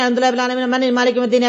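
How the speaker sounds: words run together in a hurry, slurred; rushed recitation. Shown as a demonstration. Preacher mocks hasty Quran reading, rushed and slurred. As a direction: gabble the verses to finish fast. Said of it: "ویسے نہیں".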